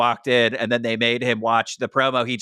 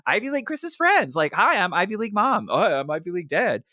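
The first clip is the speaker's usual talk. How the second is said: much higher, gushing mock-parent voice; mock cheery parents; 7 semitones higher than usual. Parents greeting their son's guests, gushing and high-pitched. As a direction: play the eager, welcoming Ivy League mom and dad. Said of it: "His parents seemed so nice and were like".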